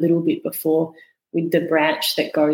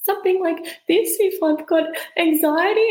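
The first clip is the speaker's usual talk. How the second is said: tight, much higher anxious voice; 12 semitones higher than usual. Shown as a demonstration. Demonstrating anxiety to a podcast host, pitched much higher. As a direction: sound strung up and anxious. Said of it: "my voice might sound something like this".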